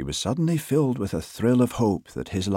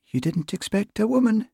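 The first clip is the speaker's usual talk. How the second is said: very high, lilting island question; soft Western Isles lilt. A narrator's reading. Constable challenging flustered sergeant, soft Western Isles lilt. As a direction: challenge him in a light Hebridean lilt. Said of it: "in a soft voice delicately laced with an endearing lilt".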